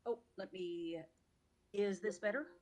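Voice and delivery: distant voice, off mic; far from mic. Real, not acted. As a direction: still faint, away from mic. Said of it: "not much".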